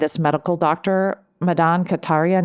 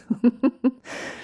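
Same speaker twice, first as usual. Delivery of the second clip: laugh at own pun; 7 semitones higher than usual. Real, not acted.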